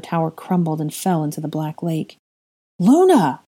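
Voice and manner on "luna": a high, loud shout of her name. Acted. Dean shouting to Luna across the grounds. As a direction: yell to get her attention from afar. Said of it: "a voice shouted".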